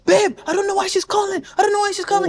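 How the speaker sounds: high, frantic mock excuses; pleading boyfriend. Acts cheating boyfriend's frantic excuse.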